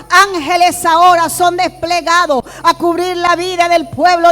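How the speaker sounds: preacher cancelling every attack, loud and fervent; full-force preaching. Acted. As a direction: proclaim it over the church with rising fire. Preacher declares over congregation.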